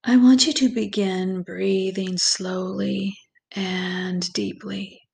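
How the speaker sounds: slow, calm meditation voice with long pauses; guided meditation. Acted. Guide leads listeners through breathing and visualization, slow meditation voice. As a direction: ease the listener gently into relaxation, unhurried and even.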